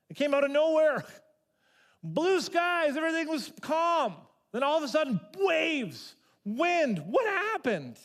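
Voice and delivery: loud, high, urgent preaching; preaching at full force. Acted. Pastor preaching the storm scene to congregation, loud and urgent. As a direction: paint the sudden storm for the congregation.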